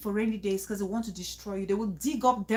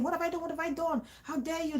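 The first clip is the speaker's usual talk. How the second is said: higher, softer imitation of an upset victim; mock pleading protest; 5 semitones higher, 5 dB quieter than usual. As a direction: plead and protest in a small, raised voice. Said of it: "screaming and crying and pleading and shouting".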